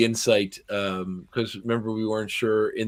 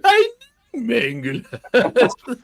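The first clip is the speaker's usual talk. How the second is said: slow, much higher hammy villain voice; mustache twirling villain; 14 semitones higher, 6 dB louder, much slower than usual. Retold or imitated. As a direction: overplay the cartoon bad guy. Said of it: "way more of a cartoon villain".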